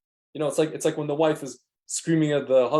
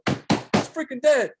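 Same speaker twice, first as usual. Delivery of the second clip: mock angry customer; 9 semitones higher, much slower than usual. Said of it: "The guy's like, slam goes".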